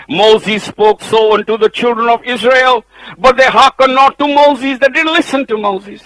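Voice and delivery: full-voiced sermon delivery; preaching at full force. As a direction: proclaim it like a pulpit preacher. Reading scripture at full force.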